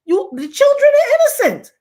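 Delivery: high, loud insistence; indignant outcry. Retold or imitated. Repeats her indignant objection.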